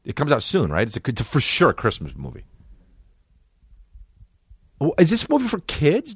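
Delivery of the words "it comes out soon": ordinary delivery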